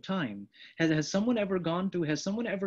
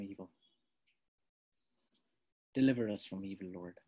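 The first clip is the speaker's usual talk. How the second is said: hushed appeal; 8 semitones lower, 8 dB quieter than usual. Real, not acted. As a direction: draw them in with a hushed voice.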